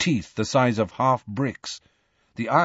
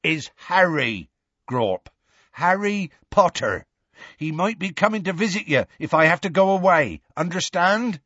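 Hagrid introducing; 7 semitones higher than usual. A narrator's reading.

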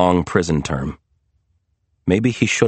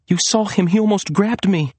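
urgent insistence; 11 semitones higher than usual. A narrator's reading. Insisting to doubting sister.

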